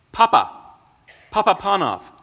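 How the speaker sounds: high, loud calling of his name; a voice ringing out in the room, high and loud. A narrator's reading. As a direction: call out to wake him. Mysterious voice calls his name.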